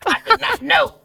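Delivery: high, excited cry of surprise; delighted high surprise; surprised exclaim. Real, not acted.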